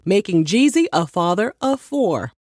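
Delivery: usual speaking voice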